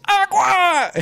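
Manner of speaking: high, loud shouted "Agua!"; yelled demand. Retold or imitated. Sweaty customer shouting for water at counter, loud yell. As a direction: bellow "Agua!" like a desperate thirsty man.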